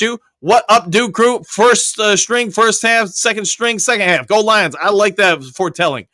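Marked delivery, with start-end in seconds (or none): none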